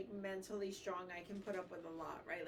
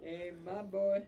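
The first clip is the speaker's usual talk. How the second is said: slow, loud teasing call; teasing drawl; 7 dB louder, slower than usual. Pretend. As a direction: stretch it out, mocking. Teasing repeat of the line.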